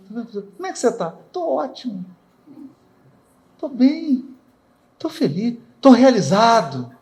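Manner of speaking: lightly voiced, chirpy self-satisfied greeting, pitched high; a preacher mimicking a cheerful sinner who says he's doing great; smug happy person. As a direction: play someone pleased with their own wrongdoing, answering brightly. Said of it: "Não é assim que a pessoa fala?".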